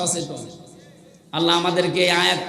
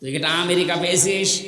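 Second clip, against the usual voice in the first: ordinary talk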